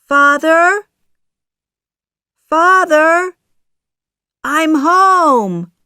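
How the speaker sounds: high, loud homecoming call; shouting. A narrator's reading. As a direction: call through the house for someone. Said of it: "shouts Pinocchio".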